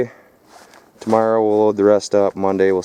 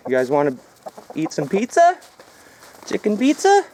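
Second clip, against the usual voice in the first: much higher, sing-song voice for the birds; talking to chickens; 11 semitones higher than usual. Pretend. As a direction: coo at the chickens like pets.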